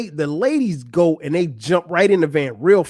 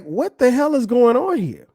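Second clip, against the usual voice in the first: high-pitched retold disbelief; baffled reaction; 7 semitones higher than usual. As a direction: replay your confusion in a higher voice. Acts out own confused reaction.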